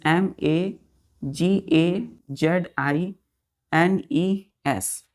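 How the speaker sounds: each letter spaced out slowly for dictation; teacher spelling a word slowly so children write it down. Shown as a demonstration. Teacher dictating letters to children, slow and spaced. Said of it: "teacher spells word letter by letter".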